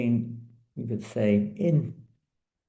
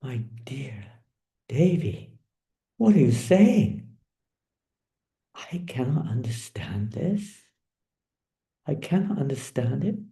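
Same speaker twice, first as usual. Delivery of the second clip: taken aback; 5 semitones higher than usual. Acted. Krishna hears he cannot understand, completely taken aback. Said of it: "Krishna was completely taken aback".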